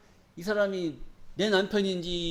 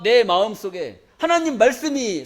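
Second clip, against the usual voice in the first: ordinary delivery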